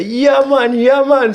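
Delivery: high, easy Jamaican agreement; my reply. Retold or imitated. He answers the Rasta man, high and easy. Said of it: "So I say".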